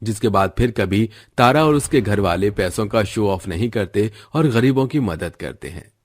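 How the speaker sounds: plain reading voice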